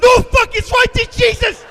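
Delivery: very high, very loud shriek; panicked scream. Acted. Passenger seeing the ground right there, panicked shriek.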